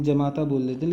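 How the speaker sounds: ordinary delivery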